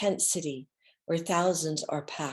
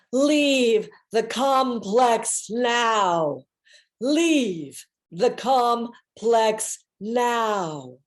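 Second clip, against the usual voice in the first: slow, louder, drawn-out command; commanding divine voice; 5 semitones higher, 6 dB louder, much slower than usual. Retold or imitated. Divine Mother ordering her to leave, slow solemn command. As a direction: intone it like a goddess giving orders. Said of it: "And I hear her say".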